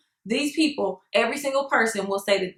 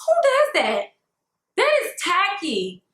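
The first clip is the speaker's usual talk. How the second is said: outraged disbelief; 7 semitones higher, slower than usual. Real, not acted. Outraged at the stranger's rudeness.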